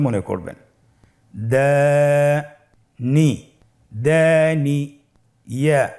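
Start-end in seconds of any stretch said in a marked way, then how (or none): 1.5-3.3 Dani split in two, first syllable held very long, then a pause; very drawn-out Dani with a gap in the middle; broken into syllables. Shown as a demonstration. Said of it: "ভেঙে ভেঙে পড়ব". 3.9-4.8 দানি run together with a long first vowel; connected Dani, no gap between syllables; syllables joined. Shown as a demonstration. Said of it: "ভেঙে ভেঙে পড়ব".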